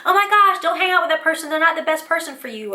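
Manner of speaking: high-pitched parental warning; worried parent. Retold or imitated. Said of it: "You hear parents say".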